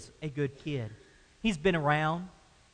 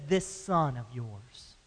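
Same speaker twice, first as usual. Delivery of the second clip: cold disdain; 7 semitones lower, 5 dB quieter, slower than usual. Retold or imitated. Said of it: "won't even call him his brother".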